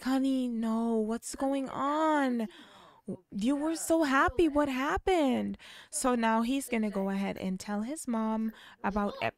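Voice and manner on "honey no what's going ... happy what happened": high, slow worried mother; worried mom. Retold or imitated. Mom begging her son to explain, high and slow. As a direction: drag it out with concern.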